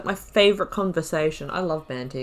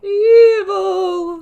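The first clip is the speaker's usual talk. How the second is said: a much higher, louder drawn-out cry; mock dramatic; 12 semitones higher, 9 dB louder than usual. Player mocking the NPC's spooky word choice. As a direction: ham up the word like a horror trailer.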